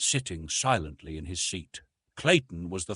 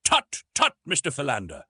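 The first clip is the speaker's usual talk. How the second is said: much higher, louder scolding tut; tut-tutting; 6 semitones higher, 5 dB louder than usual. A narrator's reading. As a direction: chide a colleague. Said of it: "said Professor Porter".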